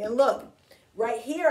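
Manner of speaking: usual speaking voice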